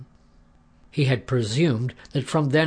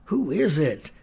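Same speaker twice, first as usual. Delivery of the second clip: ordinary delivery, nothing marked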